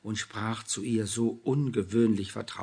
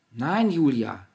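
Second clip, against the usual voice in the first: louder, calling out Nein with a fall; ringing call; 4 semitones higher, 9 dB louder, slower than usual. A narrator's reading. Anna calling from the changing room, loud. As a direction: call it out from behind a door. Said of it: "schallte es aus der Kabine".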